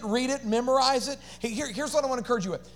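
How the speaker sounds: ordinary delivery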